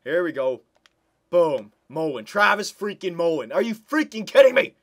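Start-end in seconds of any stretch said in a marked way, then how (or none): 1.3-4.8 loud, high cry of disbelief; can't believe this goal; incredulous outburst. Real, not acted. Gamer crying out in disbelief at a surprise goal.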